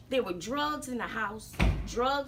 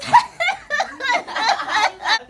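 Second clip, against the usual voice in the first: high cackle; 13 semitones higher, 11 dB louder than usual. Acted. Laughs at own joke.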